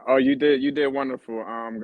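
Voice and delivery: usual speaking voice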